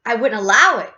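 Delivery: loud, firm refusal; crying out. A narrator's reading. Mister Wonka refuses the fudge idea, crying out. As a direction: declare it forcefully. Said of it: "cried Mister Wonka".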